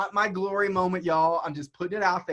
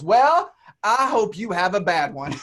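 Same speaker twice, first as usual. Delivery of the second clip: loud, slow mock yell of a spiteful agent; a spiteful parting shot, hammed-up and loud; retold yell; 8 dB louder than usual. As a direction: yell it across the airport, slowly. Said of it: "she yells".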